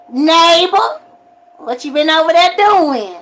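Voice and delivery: playful churchgoer voice; mock church call. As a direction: tease the neighbor in church, slow.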